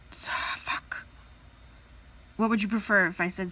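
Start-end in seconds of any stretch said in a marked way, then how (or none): 0.1-1.1 breathy whispered swear; hushed so, fuck; whispered curse. Real, not acted. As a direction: swear under your breath. Swears under her breath.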